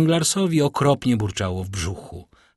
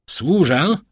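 raised, louder obliging call; polite service; 6 semitones higher, 6 dB louder than usual. A narrator's reading. Bandit answers the knock at the door, obliging. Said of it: "nauczywszy się znać uprzejmości".

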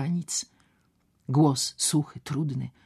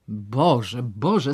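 exasperated groan rising louder; 8 dB louder than usual. Acted. Doctor groans at Ewa's romantic devotion. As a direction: groan it out, building. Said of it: "jęknął doktor Wilgosiński".

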